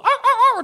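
high screeching dinosaur call; loud, high raptor shriek. Shown as a demonstration. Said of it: "That's my dinosaur noise".

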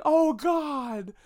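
high-pitched horrified gasp of a viewer; mock horrified cry. Watching a shocking movie scene with friends, high mock horror. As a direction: squeal it like you can't watch. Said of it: "me and my friends going".

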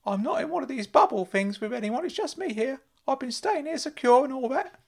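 high, loud eager uncle; Uncle Claude calling out. Acted. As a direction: squeal his name like a giddy fussing uncle. Uncle Claude voiced by narrator.

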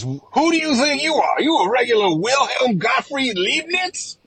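high-pitched scolding character in a hammed-up accent; Mother Nature. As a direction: berate them in a shrill, drawn-out put-on voice. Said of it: "Mother Nature comes walking in, looks at, uh, Tim Cook and says".

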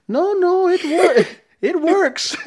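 put-on very high, loud Italian accent; flustered cartoon Italian accent, breaking into laughter. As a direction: protest in an exaggerated Italian accent. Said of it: "He was, like, Italian".

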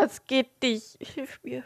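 pleading through a sneeze that won't come; strained with the sneeze stuck. Real, not acted. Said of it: "der Nieser will einfach nicht raus".

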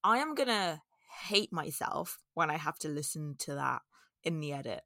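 ordinary delivery

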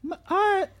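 high put-on girly voice; man doing a woman's voice. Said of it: "Can anyone do a really good girl's voice?".

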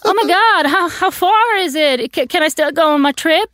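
high, put-on panicky enthusiasm; mock stressed-out guy. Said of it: "se den stressade snubben som bara".

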